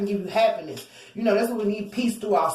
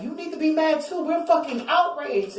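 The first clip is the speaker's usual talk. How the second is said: higher, hammed-up outraged quote; mock outrage; 7 semitones higher than usual. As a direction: play the fuming crowd. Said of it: "and they're like".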